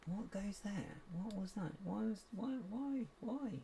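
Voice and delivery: high, fussing string of questions; mock bafflement.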